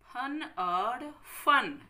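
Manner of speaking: फन said first with plain फ, then फन with a nukta f sound, very slowly; slow teacher's demo of ph versus f; slow careful contrast. Shown as a demonstration. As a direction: drag out फन and फन as phan then fan. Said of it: "ध्यान से पढ़ते हुए बोलिए".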